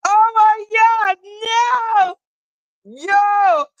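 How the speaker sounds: loud high laughing cry; laughing scream. Retold or imitated. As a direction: shriek it through laughter. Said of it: "I was just like".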